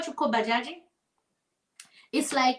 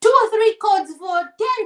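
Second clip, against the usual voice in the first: ordinary talk, nothing marked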